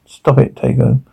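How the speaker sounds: ordinary talk, nothing marked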